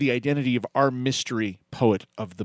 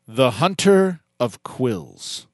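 ordinary talk

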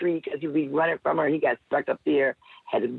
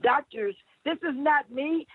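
mock scream; 12 semitones higher than usual. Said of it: "I screamed".

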